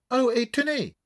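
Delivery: put-on voix douce et aiguë, accent anglais caricatural; vieille Anglaise distinguée. A narrator's reading. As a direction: une mamie britannique charmée qui réclame la suite. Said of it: "voix douce et distinguée". (vieille dame anglaise au ton doux et distingué)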